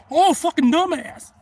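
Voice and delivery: loud, high, drawn-out exasperation; retold exasperated yell. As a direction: stretch it out in loud disbelief. Exasperated at man ignoring warnings.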